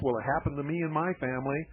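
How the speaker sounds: ordinary delivery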